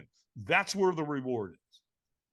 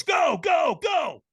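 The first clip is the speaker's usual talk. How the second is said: high, loud repeated shout; urgent retold trainer's shout; shouted launch command; 11 semitones higher, 8 dB louder, much slower than usual. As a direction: yell them off the start. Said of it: "all of a sudden he goes".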